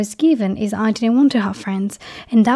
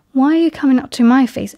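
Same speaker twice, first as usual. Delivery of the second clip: ordinary talk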